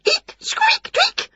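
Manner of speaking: very high squeaky mouse voice; squeaking mice. A narrator's reading. Caught mice squealing at Wilbur, in a very high squeaky voice.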